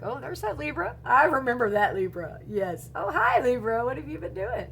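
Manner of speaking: bright, loud, delighted greeting; excited recognition. Pretend. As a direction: call out happily to an old friend. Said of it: "and you're gonna be like".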